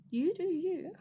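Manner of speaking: high, breezy sass; high sassy you do you; sassy dismissal. Acted. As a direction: flick it off like a diva. Sassy brush-off to haters.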